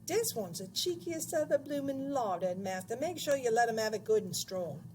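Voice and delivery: screechy woman, starting high and easing down; high-pitched screeching. A narrator's reading. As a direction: goad the headmaster in a high screech. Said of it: "dreadful high-pitched voice behind me screeching".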